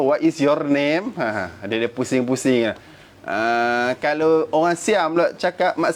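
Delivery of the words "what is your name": slow, lightly imitated Indian English accent. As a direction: drawl it out like an Indian English speaker. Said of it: "bunyi India dia tu".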